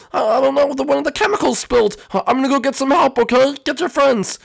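high and shaky, near tears; frightened student explaining the spill; panicked high voice. Acted. Panicked figure after the fire.

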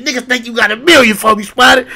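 very high, loud mimicked retort; imitating indignant comeback. Retelling the homie's retort to Spider, hammed-up indignant yell. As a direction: mimic him throwing it back, voice shooting up. Homie throws the challenge back.

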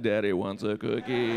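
low, drawn-level daddy voice; creepy daddy voice. Acted. Man answering a girl offering cookies, creepy daddy voice. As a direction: put on a low, self-pleased daddy.

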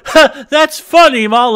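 put-on high loud childish laugh-line; mock kid voice; imitating a kid. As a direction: mimic a nine-year-old talking to mom. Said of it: "They're like".

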